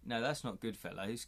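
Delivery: ordinary delivery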